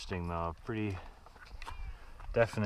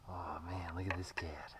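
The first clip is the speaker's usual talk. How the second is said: soft hushed voice to a cat; hushed coaxing; 3 semitones lower, 15 dB quieter than usual. Real, not acted. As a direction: murmur gently so not to scare.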